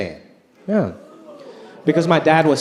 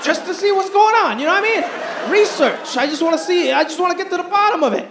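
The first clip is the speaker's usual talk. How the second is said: mock defensive; 10 semitones higher than usual.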